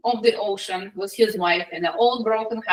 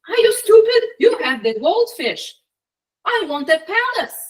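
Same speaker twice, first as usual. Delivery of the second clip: high, loud indignant outburst; acted furious demand; angry wife; 7 semitones higher, 4 dB louder than usual. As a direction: berate him, pitch shooting up. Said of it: "she looks angry".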